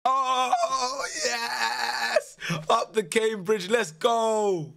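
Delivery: high, loud celebratory yell; the gamer screams as his club knocks out the giants; victory shout. Real, not acted. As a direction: roar it like your club just won. Team wins the shootout.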